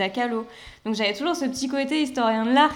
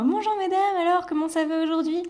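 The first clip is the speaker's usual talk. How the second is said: much higher friendly hello; cheery shop greeting; 6 semitones higher than usual. Retold or imitated. Her usual cheerful welcome to customers, lilting. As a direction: greet customers brightly and warmly. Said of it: "j'étais toujours".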